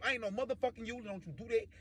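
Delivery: soft frightened protest; pleading denial. Retold or imitated. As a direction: whimper it under your breath, acted. Acts whimpering denial at gunpoint.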